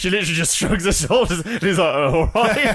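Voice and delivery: high, loud, squealing with laughter; helpless laughter. Real, not acted. Friend retelling a silent shrug, helpless laughter. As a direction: laugh so hard the words pitch right up.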